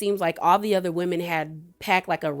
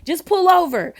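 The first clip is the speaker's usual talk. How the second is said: higher, a bit louder mock-upset insistence; upset demand; 9 semitones higher, 4 dB louder than usual. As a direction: push it as the final retold plea to stop. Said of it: "she gets so upset".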